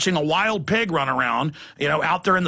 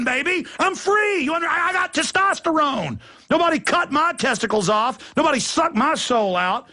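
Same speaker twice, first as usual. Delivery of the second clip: higher, fired-up boasting; defiant rant; 6 semitones higher than usual. Acted. Talk host vowing to fight a gunman, fired-up defiance. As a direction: go hog wild with defiance.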